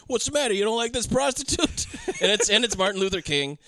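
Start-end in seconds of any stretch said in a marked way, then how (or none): 0.1-1.9 high, mocking cop imitation; taunting cop. Cops teasing the man in the car, high taunting imitation. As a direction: needle him in a raised put-on voice. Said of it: "And the cops pull up".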